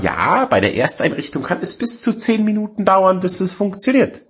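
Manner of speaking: high, sing-out instruction voice; mock notice readout. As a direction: recite it high like an official notice. Reading out the setup notice.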